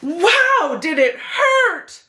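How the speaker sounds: high, slow excited cry; wow, did it hurt, pitched high and drawn out; exclaiming. A narrator's reading. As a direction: burst out as if stung. Bee sting in story.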